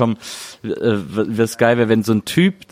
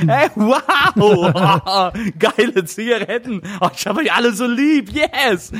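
high, giggly ecstatic voice, much higher; mock euphoric raver; 9 semitones higher, much slower than usual. Customers in the vape shop, giddy and ecstatic. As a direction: gush like a raver high on pills.

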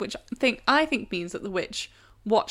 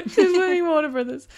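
laughing; 9 semitones higher, 4 dB louder than usual. Real, not acted. Laughing at absurd story.

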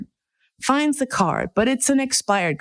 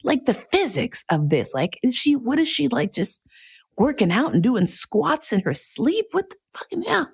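ogling disbelief; 8 semitones higher than usual. Retold or imitated. Leering inner monologue at butt.

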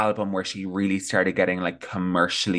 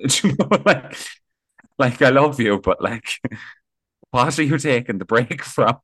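louder, higher, through laughter; giggling, raised-voice disbelief; laughing tease; 5 semitones higher, 5 dB louder than usual. Real, not acted. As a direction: laugh while you tease her. Laughing while teasing Jessie.

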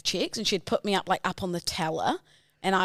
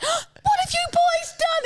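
much higher, slow shocked mum voice; mum scolding; 4 dB louder, slower than usual. Retold or imitated. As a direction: play the horrified mother finding the mess. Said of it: "she's like".

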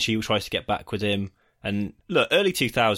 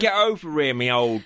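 high, hammed-up bad Australian-English accent; mock a terrible Australian-slash-English accent, pitched up; 4 semitones higher, 4 dB louder than usual. Imitating the boy band singer, bad Australian-English accent. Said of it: "very bad Australian slash English accent".

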